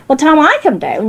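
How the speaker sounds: ordinary talk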